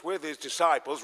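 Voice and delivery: normal talking voice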